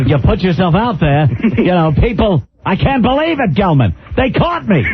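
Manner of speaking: high, excited Regis voice; Regis impression. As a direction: squeal it like a caught TV host. Mocking Regis on air.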